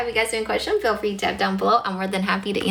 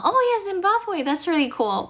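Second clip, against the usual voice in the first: sudden much higher, delighted lift; light up at a surprising answer; pleasantly surprised; 10 semitones higher than usual. Real, not acted. Streamer reacting to a viewer from Zimbabwe, delighted surprise.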